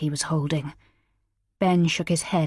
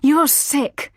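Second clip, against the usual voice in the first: disgusted; 9 semitones higher, 5 dB louder than usual. A narrator's reading. Horrified husband retorts.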